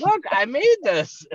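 high, loud, slow imitation; hammed-up high cheery grandma; grandma presenting food. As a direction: imitate an oblivious grandmother announcing her baking. Grandmother walks in with pastry.

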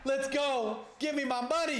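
loud, high cheer for his bet; excited cheering. Real, not acted. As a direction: whoop it up.

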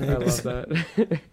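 slow, savoring repeat; slow drawn out. Shown as a demonstration. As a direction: stretch it out slowly.

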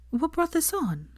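lightly voiced anxious girlfriend; concerned. A narrator's reading. Hermione worriedly asks Draco what's wrong. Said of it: "she asked, concerned".

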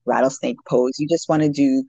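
ordinary talk

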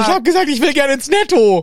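mock her line in a silly high voice; joking high parody voice. Joke parody of Hermine line.

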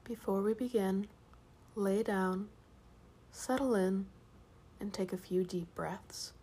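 soft hushed bedtime voice; sleep story narrator. Acted. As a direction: ease them gently toward sleep. Sleep story intro, whispered and soft.

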